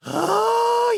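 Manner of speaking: high, savoring sigh; blissful oh. Acted. Rabbit savoring becoming a weapon, blissful.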